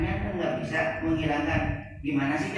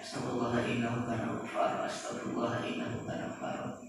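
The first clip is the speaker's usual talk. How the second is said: fast, low, soft murmured dhikr; hurried recitation, words run together; quick plain recitation; 3 semitones lower, 7 dB quieter than usual. Shown as a demonstration. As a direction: run through it quickly under the breath. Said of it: "membaca dengan baca pelan itu beda rasanya".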